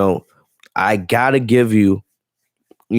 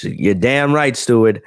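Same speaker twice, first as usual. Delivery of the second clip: normal talking voice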